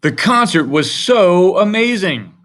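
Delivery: high, loud, slow and amazed; gushing exclamation. Shown as a demonstration. Teacher reading an example exclamation, loud and thrilled. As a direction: stretch it out like the best night ever. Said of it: "This ends in an exclamation mark".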